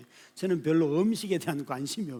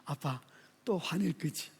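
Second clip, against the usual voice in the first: son's words; 7 dB quieter than usual. Retold or imitated. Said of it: "우리 아들이 하는 말이에요".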